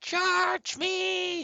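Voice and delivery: put-on very high squeaky little girl. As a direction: shriek it like a tiny girl. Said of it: "like a little girl, like, really high".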